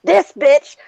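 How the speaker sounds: high-pitched indignant tirade; heated, emphatic retold fury; retold screaming rant. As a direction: rant in scandalised outrage.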